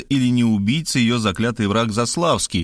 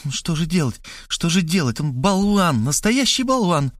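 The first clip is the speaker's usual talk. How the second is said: higher, repeating it to herself; fretful muttering; 6 semitones higher than usual. A narrator's reading. Markhaleva rushing about the room, fretful higher voice. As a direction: pace and fret under your breath. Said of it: "приговаривая".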